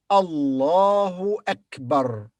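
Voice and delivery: Allahu Akbar, slow and deliberate; slow model reading. Shown as a demonstration. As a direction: read Allahu Akbar slowly, each word clear. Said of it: "can you read this?".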